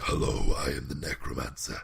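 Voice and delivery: very low, hushed villain voice; imitated necromancer. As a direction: imitate a game master's deep necromancer. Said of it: "doing the same voice".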